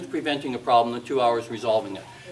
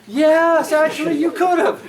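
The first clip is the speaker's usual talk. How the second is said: laughing; 12 semitones higher, 6 dB louder than usual. Real, not acted. Retorting to exasperated teacher.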